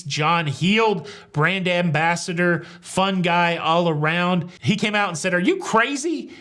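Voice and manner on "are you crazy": high-pitched British accent impression; mock British accent. Quoting the cruise line's mouthpiece, mock British accent. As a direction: put on an indignant British accent. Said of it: "he said it in a British accent".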